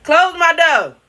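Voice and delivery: loud high call. Real, not acted. Calling to child.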